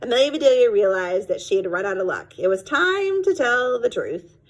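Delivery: plain reading voice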